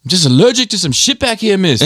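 high mock schoolboy excuse; mimicked school troublemaker. Schoolboy making excuses to his teacher, high put-on voice. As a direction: put on a high cheeky kid voice. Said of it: "He's like".

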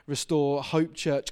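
ordinary delivery

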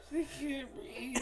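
slow, high, soft tearful whimper; drawn-out fake sobbing whine; mock crying. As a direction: imitate someone sobbing for air. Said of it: "But she's crying".

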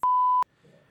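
a high, sharp retold curse; cut-off swear flung out in frustration; frustrated curse. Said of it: "I'm like".

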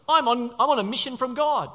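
lightly acted zealot; zealous Saul. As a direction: declare it with mock conviction. Voicing Saul's zeal.

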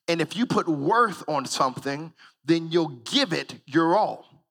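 normal talking voice

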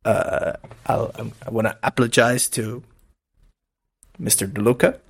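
overly solemn, low apology; mock formal apology. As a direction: apologize with fake sincerity. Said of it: "colgo l'occasione per apologize".

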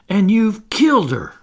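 high, loud accusing cry. A narrator's reading. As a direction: hurl the blame at him. Twin accuses Toodles.

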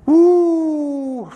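high, ringing "woo" of delight; joyful whoop. Real, not acted. Preacher whooping to the congregation after the reading, high joyful cry. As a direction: let out a glad shout of praise.

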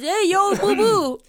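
high, loud goofy cartoon bear voice with a laugh; mock Yogi Bear. Co-host voicing a cartoon bear, high and loud. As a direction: play the bear greeting his sidekick. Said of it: "And he says".

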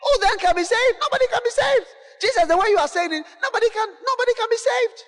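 high, pleading cry, nobody can be saved; despairing disciples. Retold or imitated. Disciples protesting to Jesus, high despairing cry. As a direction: wail it up high like men losing hope. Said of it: "It means we cry".

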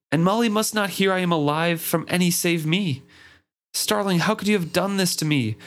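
high-pitched, anguished pleading; desperate outcry. A narrator's reading. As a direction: keep pleading desperately, pitch held up to the end. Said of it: "I cried out desperately".

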